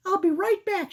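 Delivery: put-on squeaky girl mouse; high-pitched cartoon mouse. Voicing Sarah.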